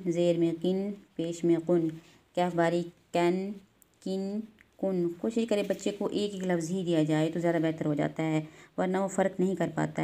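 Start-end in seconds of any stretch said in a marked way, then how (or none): 4.0-5.1 किन कुन said slowly and softly; soft, slow drill of the thin sounds; slow contrast drill. Shown as a demonstration. Teacher contrasts the two letters for students, slow. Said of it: "जैसे कौफ पुर है तो कौन".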